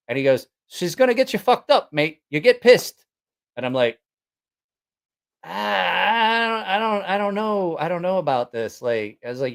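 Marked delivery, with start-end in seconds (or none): 0.7-3.0 high, punchy mock British mate; attempted British accent. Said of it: "can't do a British accent". 5.4-8.8 hesitant reluctance. Retold or imitated. Retelling own reluctance to go.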